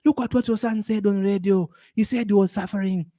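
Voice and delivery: lightly voiced tattling relative; gossiping relatives. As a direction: relatives mocking the son's radio story to his mom. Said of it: "They call my mom and say".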